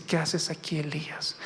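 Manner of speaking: soft, low voice, dropping away; voz apacible de Dios. Acted. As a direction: say it low and hushed, fading on the name. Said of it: "una voz claramente le dice". (voz baja y suave que se apaga)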